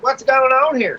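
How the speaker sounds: high, puzzled officer voice; polite officer voice. Retold or imitated. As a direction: ask it like a wary cop. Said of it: "they're just like".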